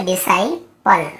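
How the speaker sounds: डिसाइपल slowly, split before the final pal; slow model pronunciation. Shown as a demonstration. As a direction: say di-sai, then pal, in डिसाइपल. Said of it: "ठीक है, ऐसे".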